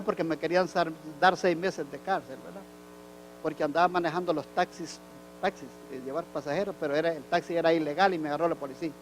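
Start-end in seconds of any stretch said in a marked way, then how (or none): none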